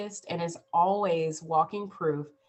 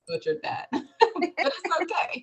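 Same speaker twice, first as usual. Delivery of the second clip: laughing; 11 semitones higher than usual. Real, not acted. Laughing at her own mistake.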